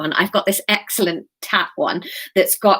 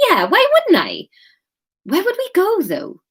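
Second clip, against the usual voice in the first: higher, livelier character voice; Kate's perky reply; 11 semitones higher, 3 dB louder than usual. Acted. Kate takes up Graham's suggestion, high perky reply. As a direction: lift it up high, warming to the idea.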